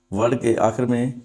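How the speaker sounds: normal talking voice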